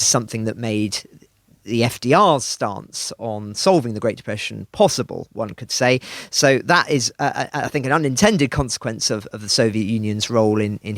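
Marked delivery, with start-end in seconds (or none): none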